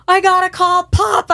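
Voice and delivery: put-on high, loud urgent kid; excited kid. Son wants to call his dad, high and loud. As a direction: insist like a child.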